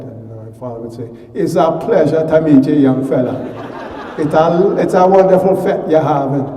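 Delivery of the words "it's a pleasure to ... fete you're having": put-on higher, louder Jamaican accent, laughing through it; booming, jovial Jamaican gentleman greeting a young man; imitating a father's thick Jamaican accent.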